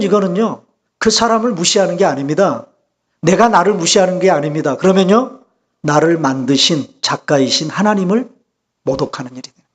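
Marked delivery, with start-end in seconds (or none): none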